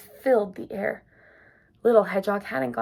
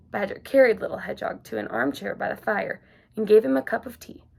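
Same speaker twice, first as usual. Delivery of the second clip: usual speaking voice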